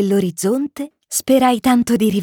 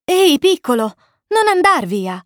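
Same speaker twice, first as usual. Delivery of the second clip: raised, higher voice, mildly urgent; calling after him; 6 semitones higher, 3 dB louder than usual. A narrator's reading. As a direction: call out to a child walking away. Pilot calls after the departing prince. (richiamo a chi si allontana)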